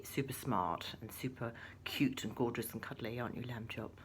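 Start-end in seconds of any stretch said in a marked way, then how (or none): none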